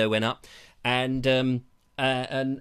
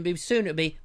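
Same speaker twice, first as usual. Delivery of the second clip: usual speaking voice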